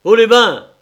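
high, loud imperious order; commanding shout. Acted. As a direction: bark the order to freeze. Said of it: "une voix impérieuse".

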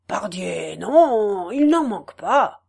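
a hint of a heavy-headed farmer; ponderous farmer. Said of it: "d'un air profond".

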